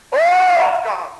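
high loud oh; shout. Real, not acted. Preacher shouting in the closing prayer, high and loud. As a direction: burst out with a big oh.

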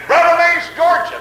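high, loud read-out of an address; loud proclaimed address. Retold or imitated. Preacher retelling a letter's address to his congregation, loud high proclamation.

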